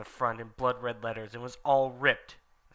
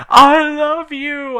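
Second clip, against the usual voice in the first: swooning; 15 dB louder, much slower than usual. Acted. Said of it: "I said".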